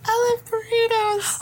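high, slow squeaky mouse voice. Acted. Player copies mouse voice.

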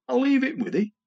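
lightly voiced poet, lifted high in pitch; Norman. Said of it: "Norman came to my house and said".

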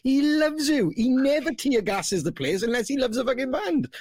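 high, put-on Swansea local voice, fast and breezy; reassuring locals. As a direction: play a cheery local shrugging off the tear gas. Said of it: "everyone's like".

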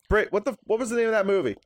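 high call up the stairs; yelling across the room. Real, not acted. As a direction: shout to someone upstairs. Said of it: "you just yelled up the stairs".

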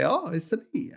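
high-pitched cartoon game-hero voice; Mario. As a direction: squeak it out like the game's little hero. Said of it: "was the original Mario voice".

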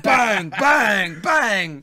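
loud hammed-up bangs, falling; mock shouting. Acting out the manager smashing players at half time, hammed-up shout. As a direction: punch out each bang.

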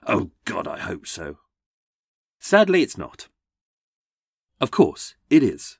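ordinary delivery

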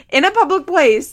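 can you believe it, loud and high; incredulous outburst. Real, not acted. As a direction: blurt it out in disbelief. Stressing the outrageous setting.

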